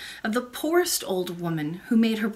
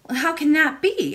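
higher-pitched, puzzled princess; bewildered child; 6 semitones higher, 4 dB louder than usual. A narrator's reading. Princess questions the old lady about her name, bewildered.

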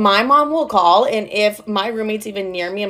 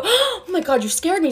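much higher, jumpy acted fright; hammed-up little scare; mock startled; 9 semitones higher than usual. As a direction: play being caught off guard. Startled by roommate's tap.

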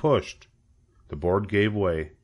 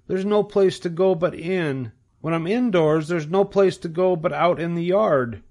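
high, world-weary piglet voice; bored young pig; 8 semitones higher than usual. A narrator's reading. Young pig tired of living, high and weary. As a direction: pitch it up for a little pig.

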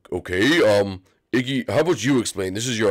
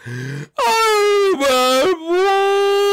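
slow, high, whiny self-pity; mock whimper; 4 dB louder, much slower than usual. Iggy sulking to his brothers that he's a bad boy, slow and whiny. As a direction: drag out each word like a sulking child.

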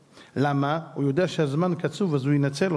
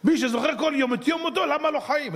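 higher, mocking voice of a passerby; mocking man in the street; 9 semitones higher than usual. Rabbi mocks the man in the street's view, higher and sneering. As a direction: play the street cynic with a sneer. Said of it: "אגיד לך הפוך".

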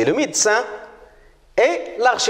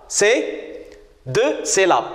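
ordinary talk, nothing marked

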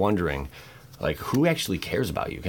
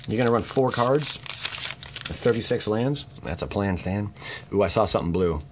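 usual speaking voice

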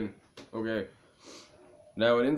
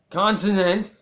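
much higher and louder on "consonant"; mock exasperated shout; 9 semitones higher, 10 dB louder than usual. Real, not acted. Reader correcting a slip on consonant, mock exasperated shout. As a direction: burst out with a loud, high "consonant!".

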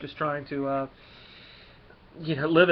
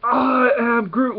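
high, loud and slow Groot line; 8 semitones higher, 6 dB louder, much slower than usual. Acted. Reviewer voicing Groot toasting his friends, slow and loud. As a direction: stretch out I am Groot like the tree creature. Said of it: "And he goes up there".

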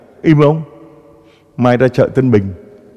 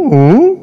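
mute grunt; 8 semitones higher, 6 dB louder than usual. Pretend. Mute gossipers only grunting.

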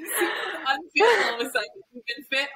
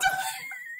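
laugh; 5 dB quieter than usual. Real, not acted. Listener laughs after her quote.

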